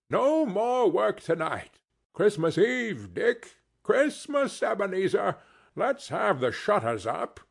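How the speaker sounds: rally the lads joyfully; jovial Fezziwig call. A narrator's reading. Fezziwig calling out.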